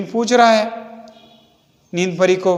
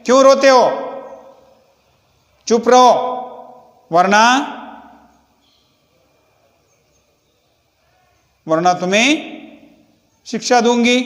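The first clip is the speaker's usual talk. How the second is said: shouting; 3 semitones higher, faster than usual. A narrator's reading. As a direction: yell at the crying kids to hush. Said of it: "चिल्लाकर जोर से पूछती है".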